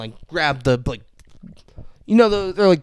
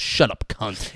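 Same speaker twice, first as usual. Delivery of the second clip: offhand, low and softer; casual; 4 semitones lower, 5 dB quieter, slower than usual. Retold or imitated. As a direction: toss the insult out without yelling. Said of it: "But just casually".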